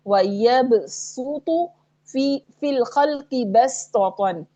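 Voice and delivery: slow recited "वयबसुतु" and "बसतन" with plain s; seen only. Shown as a demonstration. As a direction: recite "बसतन" with a light seen, not a heavy saad. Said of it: "इन दोनों में सिर्फ सीन पढ़ेंगे".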